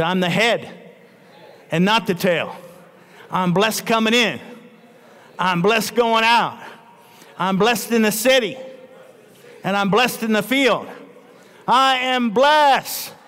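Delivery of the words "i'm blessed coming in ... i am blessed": declaration building higher to stretched hallelujahs; rallying declaration. Real, not acted. Preacher leading the church in declaring blessing, rallying, exultant.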